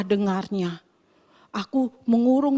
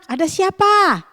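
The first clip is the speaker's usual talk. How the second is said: normal talking voice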